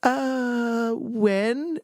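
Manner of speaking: high, halting mock-doubtful reply; hesitate, then ask as if not buying it; wary hesitation. Wary answer to supposed prank call.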